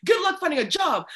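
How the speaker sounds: loud, high-pitched teacher tirade; mock scolding. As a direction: imitate a teacher railing at a student. Acts out teacher berating a student.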